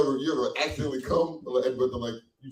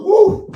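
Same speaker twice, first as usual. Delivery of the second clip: high, loud "woo" of relief; loud whoop; 13 dB louder than usual. Acted. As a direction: whoop as if blown away. Acting dazed admiration afterwards.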